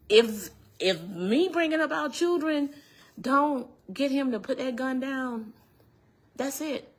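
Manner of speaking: high, insistent realization of lost hope; desperate disbelief. Retold or imitated. Survivor recalls her last hope failing, desperate. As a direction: pitch it up high, pressing each phrase.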